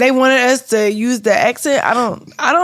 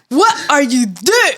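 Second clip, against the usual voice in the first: mock UK accent; 4 semitones higher, 3 dB louder than usual. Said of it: "it's when you said".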